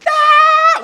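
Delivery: very high, loud yell; demonstrated scream. Shown as a demonstration. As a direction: scream it like a punchline. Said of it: "I would yell like".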